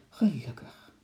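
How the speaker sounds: whispered sigh of relief; relieved whisper. A narrator's reading. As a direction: breathe it out in relief. Heroine relieved as animal is caged.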